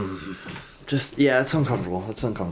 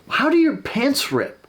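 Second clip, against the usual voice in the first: incredulous; 12 semitones higher, slower than usual. Real, not acted. Disbelief at ripped pants.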